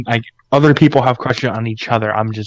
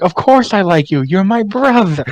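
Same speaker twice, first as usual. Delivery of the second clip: oblivious Emma; 9 semitones higher, 4 dB louder than usual. Retold or imitated. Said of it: "and she's like".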